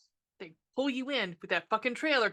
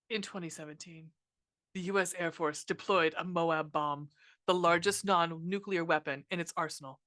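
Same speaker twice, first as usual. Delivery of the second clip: low, softer on-screen text reading; title card read-out; 5 semitones lower, 5 dB quieter than usual. Acted. Reads title screen text aloud.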